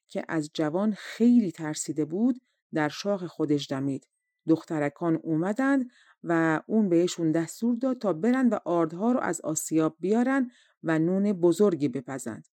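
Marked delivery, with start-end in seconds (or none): none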